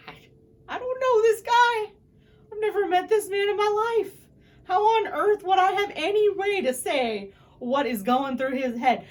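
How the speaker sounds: high, loud, incredulous protest; exasperated disbelief. Retold or imitated. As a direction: make it sound absurd they'd even ask. Indignant reply to police question.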